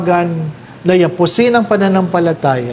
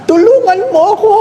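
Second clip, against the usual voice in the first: high, fast panicked plea to God; a man clinging to a branch, begging to be saved; hammed-up frantic prayer with a laugh; desperate cry for help; 14 semitones higher than usual. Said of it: "Sabi niya".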